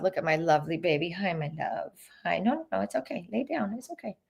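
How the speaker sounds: soft, hushed coaxing of a dog. Real, not acted. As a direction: soothe the puppy gently. Talking to her dog.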